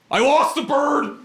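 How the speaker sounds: slow, high-pitched mock wail; distraught employee. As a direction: confess it in a high, cartoonish cry. Worker confesses losing the bird.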